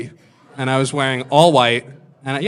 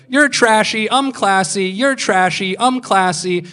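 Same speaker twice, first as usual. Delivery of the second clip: higher, repetitive sing-song taunting; mocking back-and-forth, pitched up; mock bickering; 6 semitones higher, slower than usual. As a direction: mimic housemates trading petty jabs. Housemates bickering, mimicked.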